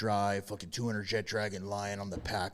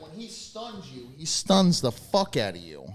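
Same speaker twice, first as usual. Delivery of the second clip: ordinary talk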